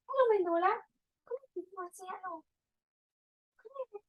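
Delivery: high-pitched pet-talk; talking to her dog. Real, not acted. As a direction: coo and coax the dog over in a high voice.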